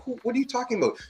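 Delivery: baffled disbelief. Retold or imitated. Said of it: "I'm like".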